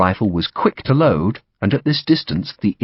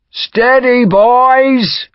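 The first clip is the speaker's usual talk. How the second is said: high, loud, drawn-out holding call; shouted restraint; 6 dB louder, much slower than usual. A narrator's reading. Commander to men eager to charge, shouting. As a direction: shout to hold the men back. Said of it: "Gough shouted".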